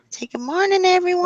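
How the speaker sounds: high sing-song voice for a puppy. Real, not acted. Baby talk to the puppy.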